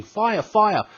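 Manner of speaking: high-pitched mock cry of alarm; mock scream. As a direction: pretend to raise the alarm. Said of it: "I wasn't screaming".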